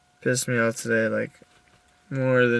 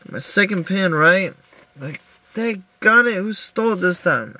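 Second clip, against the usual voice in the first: freaking out; 6 semitones higher than usual. Retold or imitated. Retelling the lost pin, frantic and high-pitched. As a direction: relive the panic of losing the pin. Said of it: "I was freaking out, like".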